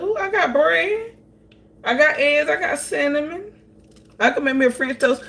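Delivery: high, eager voice, delighted at the idea; excited self-talk. Retold or imitated. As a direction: squeal the lucky find. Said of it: "I said".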